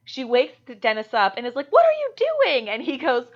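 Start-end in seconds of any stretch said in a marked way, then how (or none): 1.7-2.7 high, loud scolding question; mock alarm. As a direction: imitate a wife aghast at her husband. Retelling wife waking husband, alarmed.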